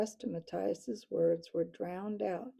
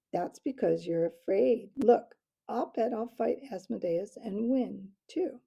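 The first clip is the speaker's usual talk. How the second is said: loud jeering dare; mocking call; 3 semitones higher, 8 dB louder than usual. A narrator's reading. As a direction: goad the owl. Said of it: "Matthias called mockingly".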